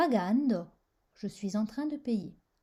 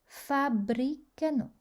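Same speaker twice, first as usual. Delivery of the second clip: fabbricano, slow, stressed on FAB; stress on fourth-last syllable; 3 semitones higher, slower than usual. Shown as a demonstration. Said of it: "sur la quatrième syllabe avant la fin". (accent tonique sur « fab » dans fabbricano)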